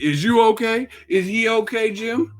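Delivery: high, smug mock fighter; mock petty boxer. Podcaster mimicking Charlo at an interview, high and mocking. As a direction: taunt the beaten man with a singsong.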